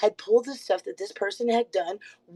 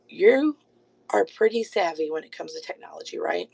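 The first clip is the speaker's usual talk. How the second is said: sarcastic; 6 semitones lower than usual. Real, not acted. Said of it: "That's sarcasm".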